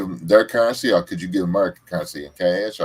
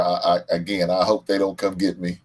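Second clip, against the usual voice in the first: ordinary delivery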